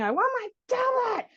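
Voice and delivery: mimicking a kid's tablet demand; whiny child. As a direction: whine it like a tantrum child. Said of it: "he was screaming".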